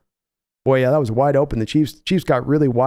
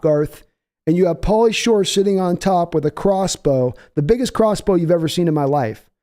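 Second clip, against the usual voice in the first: ordinary talk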